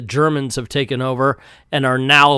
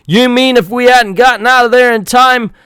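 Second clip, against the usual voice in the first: outraged; 10 semitones higher, 6 dB louder than usual. Acted. Outraged commando.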